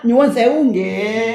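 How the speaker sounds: nyuwun sewu nggih spoken slowly and deliberately; slow courteous demo; polite formal Javanese. Shown as a demonstration. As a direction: show the respectful phrase slowly. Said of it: "kalau orang besar".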